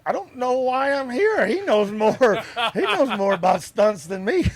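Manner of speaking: high, loud, laughing through the words; laughing stunt guy. Retold or imitated. Stunt guy on set laughing at the situation. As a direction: giggle out the stunt man's words. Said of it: "and he's like".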